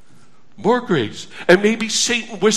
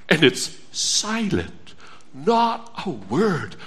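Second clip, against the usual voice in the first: hushed; 5 semitones lower, 9 dB quieter, slower than usual. Acted. Preacher dwelling on God's silence.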